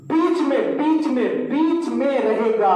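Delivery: teacher drilling बीच में with heavy stress; emphatic repetition. Shown as a demonstration. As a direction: hammer home बीच में for the class. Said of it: "teacher stressing the rule".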